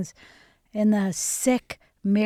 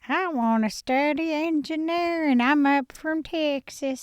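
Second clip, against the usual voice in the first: young Texan impression; 3 semitones higher than usual. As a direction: play an earnest Texas student, stretching the vowels. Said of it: "That's a great impression".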